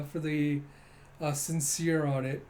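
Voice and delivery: ordinary talk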